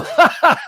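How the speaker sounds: high, loud fake laugh; mock chuckle. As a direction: laugh it off like a clumsy killer. Mocking stabber laughing it off.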